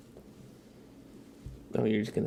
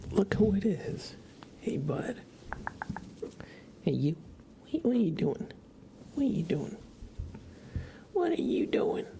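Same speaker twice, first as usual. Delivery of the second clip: sing-song pet voice, much higher; 11 semitones higher than usual. Real, not acted. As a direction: coo at the little animal on your desk. Cooing at pet on desk.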